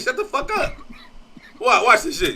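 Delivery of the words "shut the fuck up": imitating gangster.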